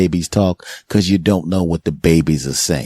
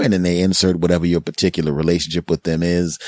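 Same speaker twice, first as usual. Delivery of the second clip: ordinary talk, nothing marked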